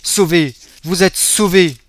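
put-on high, loud, overjoyed girl; breathless joy. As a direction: burst out with relief. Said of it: "hors d'haleine et presque mourante de joie". (fille hors d'haleine, folle de joie)

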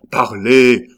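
Parlez lancé fort, net; ordre sec. Acted. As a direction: exige la réponse, fort. Sommation au comte. (ordre lancé fort pour faire parler)

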